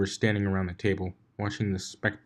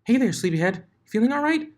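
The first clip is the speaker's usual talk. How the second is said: much higher, chirpy greeting; higher Pinkie voice; 4 dB louder than usual. Acted. Pinkie greeting the groggy Blitz, high and chirpy. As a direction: redo it in the bubbly pony voice. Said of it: "wrong voice".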